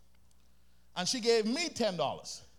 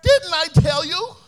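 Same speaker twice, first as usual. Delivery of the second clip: loud, high reproach; scolding mother; 7 semitones higher, 12 dB louder than usual. Acted.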